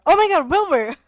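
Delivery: high, loud fangirl gush; excited squeal. Real, not acted. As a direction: gush over a favorite. Gushing over favorite creator.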